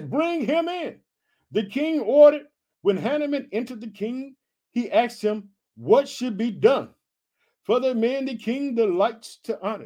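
high, measured Bible reading; scripture reading. A narrator's reading. Reads scripture aloud.

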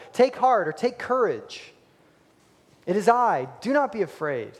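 ringing proclamation. Acted. Quoting Jesus, ringing.

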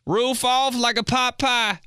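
high, slow rap-line delivery; rapper bar. Pretend. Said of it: "That should be a [name] line".

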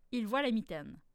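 ordinary delivery

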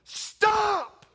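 high, loud scream of protest; sudden piercing shout; screamed "stop". Retold or imitated. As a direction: scream it out, overwhelmed, in one drawn-out burst. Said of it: "that I scream".

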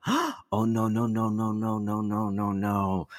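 gasped, rattled-off string of no's; mock panic. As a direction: recount it in dismayed mock panic. Retelling panicked reaction to bad news.

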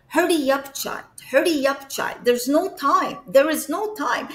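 loud, high urging; urgent. Retold or imitated. Late Pope rushing the child out, urgent. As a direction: hurry the child along with insistent repeated commands.

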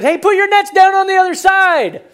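high, loud long-distance holler; yelling out. Acted. Preacher as Jesus directing the fishermen from shore, yelling. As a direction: call out across the water. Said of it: "he yells out to him".

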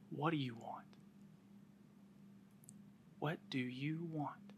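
soft, hushed ask; gentle question. Retold or imitated. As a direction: ask quietly and kindly. Jesus gently asks the beggar.

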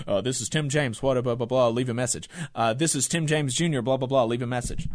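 low, soft, bored voicemail greeting; mock voicemail greeting. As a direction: drone it like a canned voicemail message. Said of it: "a message on the voicemail that says".

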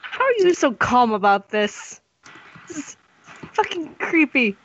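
high, tense, unnerved; creeped out. Acted. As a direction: sound spooked by the tower. Player creeped out by the tower.

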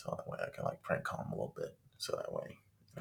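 low unvoiced mumble; mumbling. Real, not acted. Talking to his friend about the prank, mumbled. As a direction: mumble it under your breath. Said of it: "you're mumbling".

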